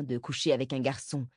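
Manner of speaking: usual speaking voice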